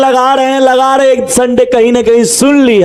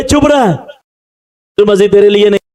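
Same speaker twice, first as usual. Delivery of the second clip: a dismissive bystander telling him off; lightly voiced annoyed crowd; scolding crowd; 2 semitones lower than usual. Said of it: "लोग बोल रहे". (भीड़ अंधे को झिड़कती है, हल्की झिड़की भरी आवाज़ में)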